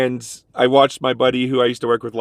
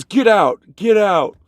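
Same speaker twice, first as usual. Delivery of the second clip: higher, louder repeated order to leave; retold angry yell; 8 semitones higher, 4 dB louder, much slower than usual. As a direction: bark him out of the store. Boss Artie throws him out.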